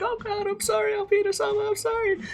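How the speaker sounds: very high, whimpering apology; mock crying. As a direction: squeal sorry like a terrified kid. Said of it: "crying or whatever".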